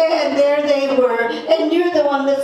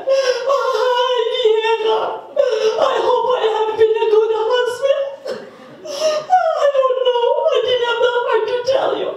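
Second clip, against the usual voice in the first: high, louder half-laughing mock sob; comic wailing; 10 semitones higher, 5 dB louder than usual. Old man wailing to his wife that he is dying, hammed-up sobbing. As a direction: wail it like a dying man hamming it up.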